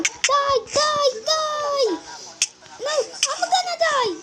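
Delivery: panicked yelling. Real, not acted. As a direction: yell it as the enemy closes in. Panicked gamer under attack.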